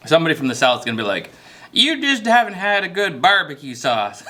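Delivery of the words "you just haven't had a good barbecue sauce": put-on Southern accent. Imagined Southerner correcting the cook, in a mock Southern accent. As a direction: tease like a Southerner defending his barbecue sauce, in a put-on Southern accent. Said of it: "Is that a good Southern accent?".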